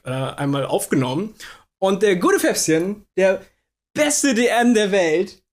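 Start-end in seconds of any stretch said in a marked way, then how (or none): 1.8-5.4 hype announcer. Pretend. Hyping up the DM.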